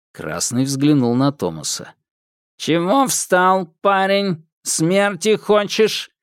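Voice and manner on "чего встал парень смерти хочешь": metallic patrolman. Acted. Armed patrolman threatens Thomas.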